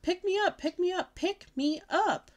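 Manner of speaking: high, loud insistent pleading; begging. Acted. Little girl jumping up and down, begging grandma loudly. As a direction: demand it over and over.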